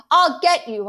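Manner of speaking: loud, high threatening yell; boy yelling after the dog. Acted. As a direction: holler it after the fleeing dog. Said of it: "I yell".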